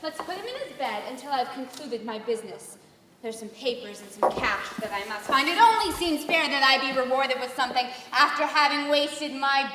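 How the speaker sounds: a hint of a villainous wife; scheming wife. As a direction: plot coldly over the body. Wife schemes over husband's body.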